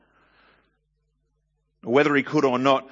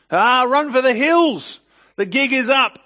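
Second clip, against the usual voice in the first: hammed-up panicked cry, much higher and louder; over-the-top alarm, run for the hills; mock panic; 14 semitones higher, 10 dB louder than usual. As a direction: shriek it like a cartoon coward fleeing. Mock panic at being recognized.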